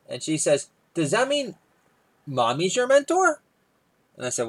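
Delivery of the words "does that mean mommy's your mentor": put-on much higher innocent child voice; high piping kid question; little daughter voice. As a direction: ask it like a curious little girl.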